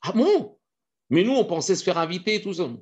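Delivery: high, loud letdown; deflate, surprised and let down; disappointed surprise. Acted. Freeloaders learning the meal was bad, high surprise. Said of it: "piques-assiettes".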